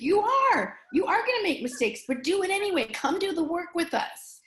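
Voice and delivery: rally the nervous friends to act; fired-up urging. Retold or imitated. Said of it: "He's like".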